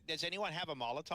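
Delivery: imitating a Canadian accent; a light Canadian accent, mocking a player. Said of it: "Why does he sound like that".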